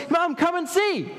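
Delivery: put-on very high, urgent child's shout for attention; child calling Mum. Child wanting Mum while sister was busy, calling high. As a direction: pipe up high to fetch Mum. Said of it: "you wanted Mum to".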